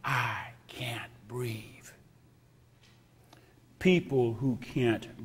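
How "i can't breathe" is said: breathless whisper, slow; faint voiceless cry for help; whispered plea. Pretend. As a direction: whisper it as if gasping for air. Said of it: "when people say".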